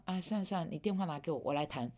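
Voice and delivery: hushed, soothing mock phone reply; soft and gentle. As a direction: speak it softly, to calm a caller down. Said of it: "我就轻声细语的跟对方谈".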